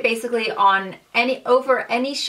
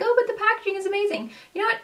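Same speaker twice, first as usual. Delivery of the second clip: high, fast, gushing mock voice; much higher, quick self-parody; imitating herself gushing; 11 semitones higher, much faster than usual. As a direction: parody her own excitement about packaging. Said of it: "when I'm like".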